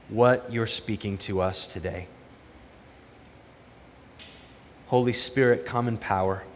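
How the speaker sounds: soft, reverent prayer voice. Acted. Pastor prays for the congregation before the sermon, soft and reverent. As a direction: pray quietly, asking humbly.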